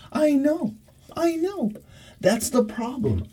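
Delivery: high soothing coo. Real, not acted. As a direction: agree with the dog up high. Talking to her dog.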